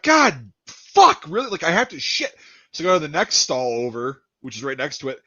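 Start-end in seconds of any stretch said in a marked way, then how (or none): 0.0-2.3 high, exasperated cursing. Retold or imitated. Man finding a filthy toilet stall, exasperated. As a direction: curse in mock dismay.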